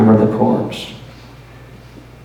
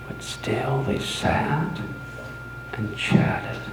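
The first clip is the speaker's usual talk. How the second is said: hushed dread; 3 semitones lower, 10 dB quieter than usual. Acted. Narrator wishing the officers gone, hushed dread. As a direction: breathe it out as the fear creeps in. Growing dread, feeling pale.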